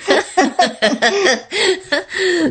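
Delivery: real high-pitched burst of laughter; hearty laugh. Real, not acted. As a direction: laugh freely along with your co-host. Co-hosts laugh about the astrology remark.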